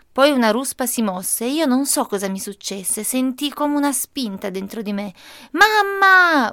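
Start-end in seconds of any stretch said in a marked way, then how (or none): 5.5-6.5 high, loud cry for mother; a boy screams for his mother as the diggers start, high and loud; desperate shout. A narrator's reading. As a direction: yell for your mother with all your breath. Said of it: "urlai con tutto il fiato".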